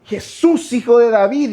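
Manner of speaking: high, loud pleading cry. Shown as a demonstration. Preacher urging the congregation to cry to Jesus, loud. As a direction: plead aloud like the blind men. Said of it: "dile al Señor".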